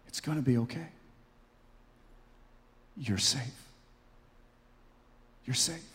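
gentle, hushed reassurance; soft, tender words with long pauses; Jesus. Acted. Pastor voicing Jesus to the dazed and confused, gentle voice. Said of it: "hear the gentle voice of Jesus".

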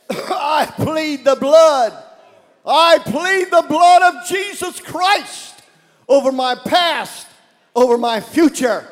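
loud, high fervent prayer; shouted pleading prayer; preaching at full force. Real, not acted. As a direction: pray it out like a revival. Leads congregation in prayer.

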